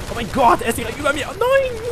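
high loud alarm; panicked. Real, not acted. Gamer with Strider above him, panicked shriek. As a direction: shriek as it closes in.

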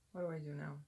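ordinary talk, nothing marked

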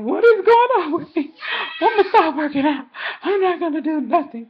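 high, loud alarmed self-talk; freaking out about your own body; worried thoughts blurted out high; panicked inner voice. Retold or imitated. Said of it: "I went to thinking".

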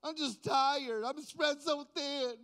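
hammed-up tired whine; whiny complaint. The flesh complaining, mock whine. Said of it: "they wanna cry and complain".

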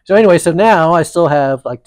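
normal talking voice

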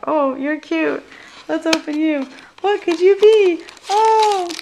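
high, loud, delighted over a tiny box; excited cooing. Retold or imitated. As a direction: coo at it like a cute surprise. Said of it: "I'm like".

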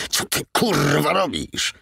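venomous hiss through the teeth. A narrator's reading. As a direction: spit it out in a furious hiss. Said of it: "wysyczał".

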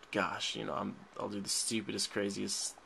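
normal talking voice

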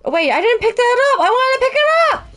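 high, fast dismayed cry; dismayed outcry. Real, not acted. Streamer wails at missing the item, high and fast. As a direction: wail that the item got missed.

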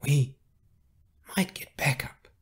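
halting, tight whisper; barely moving mouth. A narrator's reading. Carrie hiding her words from the enforcers, tight whisper. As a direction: murmur it without moving your lips. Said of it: "trying not to move her mouth".

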